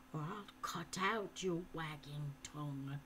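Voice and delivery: whispered menace; threatening whisper. A narrator's reading. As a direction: breathe the threat in his ear. Falconer threatens.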